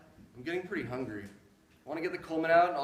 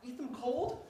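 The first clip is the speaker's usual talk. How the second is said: ordinary delivery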